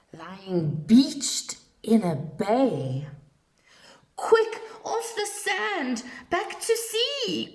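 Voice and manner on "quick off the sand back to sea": very high, tiny urgent snail voice; little snail pleading with the whale; urgent cry. Acted. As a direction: squeak it out in a panic. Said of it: "cried the snail".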